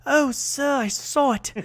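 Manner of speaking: put-on high falsetto old British nanny; mock falsetto nanny voice; Mrs Doubtfire impression. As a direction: squeak it out like a prim old nanny. Imitating Mrs Doubtfire's lime scene.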